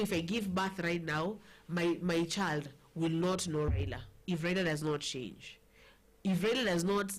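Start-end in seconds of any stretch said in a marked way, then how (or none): none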